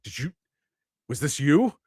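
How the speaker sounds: outraged boss demanding an answer; accusing shout. Acted. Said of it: "begins to shout and says".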